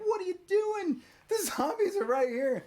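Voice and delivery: hammed-up disbelief at the friend; alarmed friends. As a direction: play the shocked onlookers. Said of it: "everybody looks at him like".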